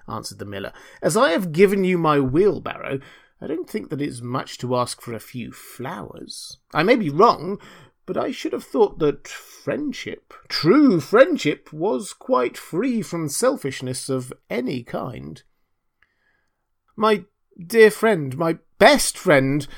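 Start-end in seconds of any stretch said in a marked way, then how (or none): 1.0-15.4 higher, sanctimonious swoops on key words; reproachful miller. Acted. Miller reproaching Hans over flowers, pompous. 17.0-19.7 loud, higher, imploring little Hans; fervent cry. A narrator's reading. Hans reassuring his best friend, fervent. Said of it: "cried little Hans".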